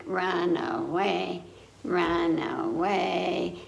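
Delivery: drawn out, very slow call; slow playful run away. Acted. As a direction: stretch each word slowly. Game ending line.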